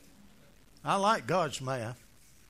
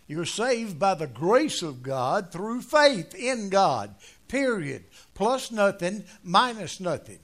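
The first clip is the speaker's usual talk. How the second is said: slow, louder emphatic preaching; 5 dB louder, slower than usual. Acted. Preacher insisting grace alone saves, slow and firm. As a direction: lay down each word like law.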